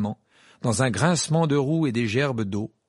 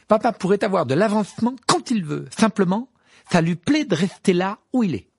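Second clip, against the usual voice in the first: higher and louder, indignant; heated defence; 9 semitones higher, 6 dB louder than usual. Acted. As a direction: fire back for his father. Ron defends his father.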